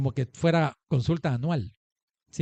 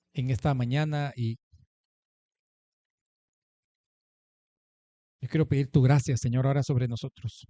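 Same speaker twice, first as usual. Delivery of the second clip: closing prayer. Acted. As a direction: pray over the standing congregation. Pastor leads closing prayer.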